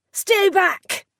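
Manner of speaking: sharp high yell to stay away. A narrator's reading. Prisoner yelling at the monster to stay back. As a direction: shout him back in panic. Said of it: "he yelled".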